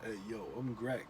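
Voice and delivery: mock impression of a friend; Greg impression. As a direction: drop it low and lazy like a bro. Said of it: "Booth Babe Greg, you ready?".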